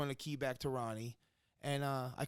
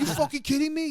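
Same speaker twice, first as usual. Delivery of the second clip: high, loud, yelling disbelief; retold outburst at the security guard, yelled high and loud; furious shout; 13 semitones higher, 10 dB louder than usual. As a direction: scream it like you've lost it. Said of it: "Remy lost his shit".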